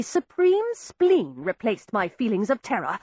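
ordinary delivery, nothing marked

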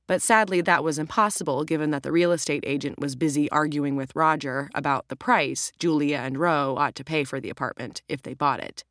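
plain reading voice

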